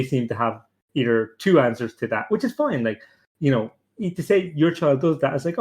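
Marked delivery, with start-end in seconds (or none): none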